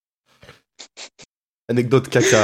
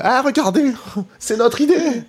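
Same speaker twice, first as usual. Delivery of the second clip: much higher, laughing exclaim; excited mock exclaim; 11 semitones higher than usual. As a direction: act out a gleeful gotcha. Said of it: "tu fais".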